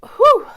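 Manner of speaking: sudden loud high sneeze; sneezing. Real, not acted. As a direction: let out a big real sneeze. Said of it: "I'm gonna sneeze".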